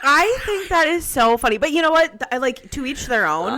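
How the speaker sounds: loud delighted reaction; amused loud reaction. Real, not acted. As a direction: burst out amused. Listener cracking up.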